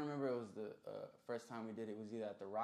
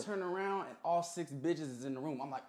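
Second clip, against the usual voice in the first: ordinary talk, nothing marked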